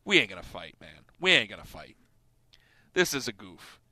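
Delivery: low, soft calming voice; hushed, it's all a joke; quiet reassurance. Retold or imitated. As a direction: talk him down gently. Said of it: "I'm like".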